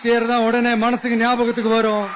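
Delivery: loud campaign oratory over a crowd; rally speech. Real, not acted. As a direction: rouse the Tiruvarur crowd, mocking the CM. Loud rally oration in Tiruvarur. (உரத்த பிரச்சார மேடைப் பேச்சு)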